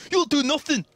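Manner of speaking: high, loud mock-Irish yell; shouting at the screen. Co-caster mimicking McGregor shouting at his screen, high mock shout. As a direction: yell it like a riled Irish fighter. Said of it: "He's, like, just shouting at the screen".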